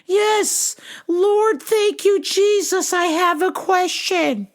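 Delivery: very high, slow quavering blessing; preachy old lady. Retold or imitated. Old caller blessing the clerk, high and slow.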